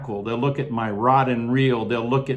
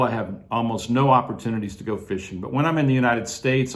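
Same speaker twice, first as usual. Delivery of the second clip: ordinary talk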